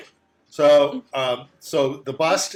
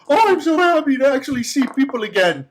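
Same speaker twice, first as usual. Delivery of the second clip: high, haughty request for wine; pompous bust; 9 semitones higher, 5 dB louder than usual. Acted.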